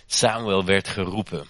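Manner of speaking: usual speaking voice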